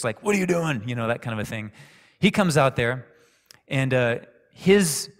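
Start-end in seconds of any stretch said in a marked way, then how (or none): 0.2-0.8 in your face. Retold or imitated. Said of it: "he's more in your face".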